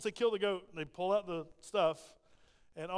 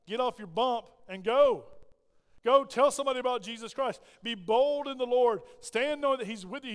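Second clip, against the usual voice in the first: loud exhortation; 3 semitones higher, 6 dB louder than usual. Real, not acted. Exhorting church to go.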